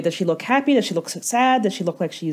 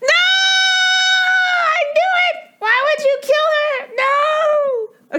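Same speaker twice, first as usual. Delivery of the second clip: high shocked shout of betrayal; real outburst; slower than usual. Real, not acted. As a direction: scream out in genuine dismay. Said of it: "I was out of character".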